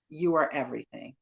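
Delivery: normal talking voice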